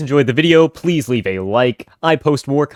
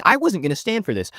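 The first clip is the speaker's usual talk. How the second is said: usual speaking voice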